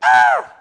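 low, loud eagle cry; eagle call. Pretend. God calling believers up to the heights, low eagle cry. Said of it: "he calls us up and say".

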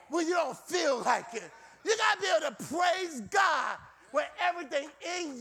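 high, emphatic sermon delivery; rousing preacher in full cry; full-force preaching. Real, not acted. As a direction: preach it hard with ringing repeats.

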